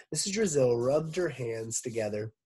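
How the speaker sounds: usual speaking voice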